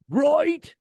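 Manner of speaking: mock rock-singer "right?" jumping way up. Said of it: "that [name], wedding singer".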